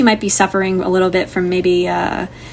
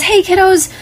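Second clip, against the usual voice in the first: cheerful dad; 11 semitones higher than usual. Acted. Dad greeting again.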